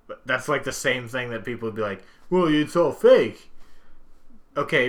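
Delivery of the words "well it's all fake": mocking dismissive. Mocking wrestling skeptics.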